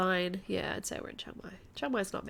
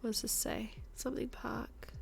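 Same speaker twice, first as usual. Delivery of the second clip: whispered muttering; 3 semitones higher, 7 dB quieter than usual. Real, not acted. Squinting at a sign.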